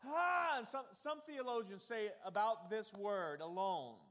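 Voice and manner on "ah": loud high anguished Ah; painful groan. Retold or imitated. Preacher re-showing Jeremiah's groan, anguished and loud. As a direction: cry it out in pain. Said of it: "it's like".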